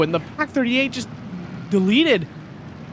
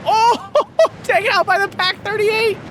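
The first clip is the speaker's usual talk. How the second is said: high, loud, laughing excited call; excited laughing shout; 13 semitones higher, 4 dB louder than usual. Real, not acted. Excited play-by-play.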